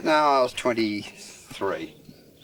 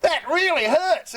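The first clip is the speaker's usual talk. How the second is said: exasperated pleading; slower than usual. Retold or imitated. Telling friend to just rip them.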